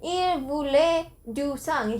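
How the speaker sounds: put-on reading the possessed girl's words in careful learner French; attempted French. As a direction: say the French line slowly, like a learner. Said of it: "see my attempt".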